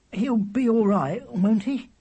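put-on anxious child. Worried boy asks about brother.

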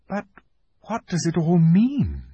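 put-on very high, calmer girl; puzzled question; Susan wondering. A narrator's reading. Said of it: "asked Susan".

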